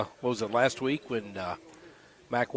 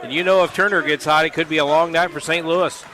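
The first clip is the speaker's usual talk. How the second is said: louder and higher, fired-up analyst; excited commentary; 5 semitones higher, 5 dB louder than usual. Real, not acted. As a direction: talk up the hot shooter with energy. Color commentator hyped after three.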